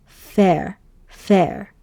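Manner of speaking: fair as one closed syllable; teacher modeling fair; pronounce fair. Shown as a demonstration. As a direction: say fair, closing on the r. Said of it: "you would pronounce it as".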